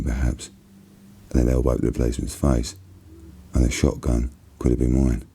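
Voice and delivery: usual speaking voice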